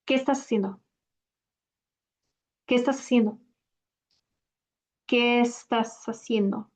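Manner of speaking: quick "¿Qué estás haciendo?" fused; casual native pace; fluent question; natural speed. Shown as a demonstration. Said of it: "I'll say it at natural speed".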